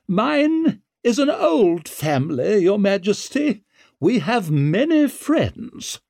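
high, slow, haughty lady's voice; imperious noblewoman. A narrator's reading. Noblewoman reminding the king of her family's friends, imperious. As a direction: draw it out grandly as a veiled warning.

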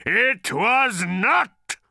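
slow, loud, high furious roar; bellow. A narrator's reading. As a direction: bellow the denial. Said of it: "came the answering bellow".

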